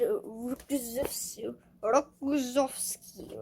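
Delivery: "Rokozovsky" drawn out slowly in a put-on Russian accent. Shown as a demonstration. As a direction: try out a slow, amateur Russian accent on "Rokozovsky". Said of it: "try to do, like, a Russian accent".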